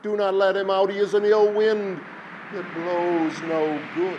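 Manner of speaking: high, loud urgent repeat; crying out. A narrator's reading. Villagers plead with Silver Hands not to free him, loud cry. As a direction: hammer the warning home loudly. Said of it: "the people cried in one voice".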